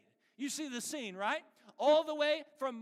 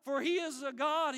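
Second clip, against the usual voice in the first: loud, raised, taunting call; mocking sarcasm; 4 semitones higher, 6 dB louder than usual. Elijah taunts the prophets of Baal at noon, mocking. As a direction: jeer at their useless god. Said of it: "Elijah mocked them".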